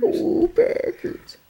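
high, slow, airy fan cheer; Minnesotan cheer. Pretend. Cheering for the Packers as a goofy Minnesota fan. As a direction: breathe out a thin Packers cheer.

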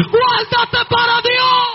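normal talking voice

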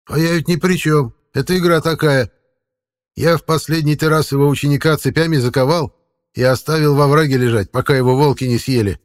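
lightly voiced old forest man; a hint of a folksy old man; creepy caretaker.

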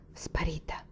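very soft, almost whispered; hushed thought. A narrator's reading. Son broods on Peonia to himself, hushed. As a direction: breathe the word to yourself.

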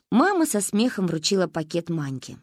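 ordinary delivery

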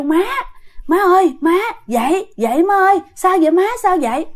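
high, worried calling; urgent waking call. Retold or imitated. Daughter wakes her screaming mother from a nightmare, urgent calling. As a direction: rouse her with anxious repeated calls.

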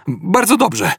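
high, light conclusion with relief; relieved. A narrator's reading. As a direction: let relief lift the pitch right up. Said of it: "podsumował z ulgą Zafod".

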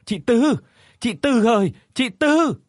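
high, alarmed cries for a neighbour; panicked calling. A narrator's reading. Neighbour runs up calling the mother in panic. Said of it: "chạy qua hốt hoảng gọi".

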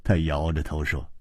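ordinary delivery

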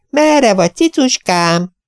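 high sing-song coo, stretched slow at the end; fluting coo. A narrator's reading. Old lady cooing for her pet cat, high and fluting. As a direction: coax the hidden cat sweetly. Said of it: "fuvolázta Mrs. Beck".

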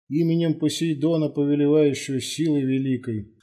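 high, sustained solemn incantation; ritual invocation. A narrator's reading. Priestly invocation at the altar. (торжественно, как заклинание, высоким голосом)

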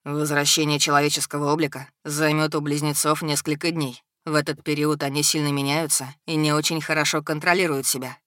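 cool, commanding dragon; stern dragon lord. Acted. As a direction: reprove evenly, pitched a little down.

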